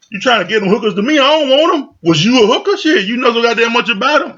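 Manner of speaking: offended man throwing the accusation back at her; mock indignant yell. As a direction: hurl it back like he's offended. [name]'s retort continues, heated.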